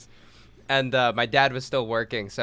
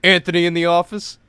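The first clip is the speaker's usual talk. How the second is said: louder, blunt demand; menacing demand; 5 semitones higher, 8 dB louder than usual. Retold or imitated. Angry worker demanding the boss, louder and blunt. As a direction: ask for the boss like a threat.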